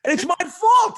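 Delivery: high, loud mock outrage; retold indignant outburst; indignant protest. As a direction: throw the blame back, exasperated. Son argues back at father.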